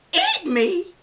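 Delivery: high, loud shocked shriek. A narrator's reading. Arnie the donut shocked at being eaten, high loud shriek. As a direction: shriek it in disbelief. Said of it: "Arnie shrieked".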